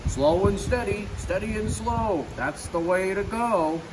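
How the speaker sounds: sing-song goofy motto, unhurried. A narrator's reading. Goofy chugging along in his old car, lightly voiced.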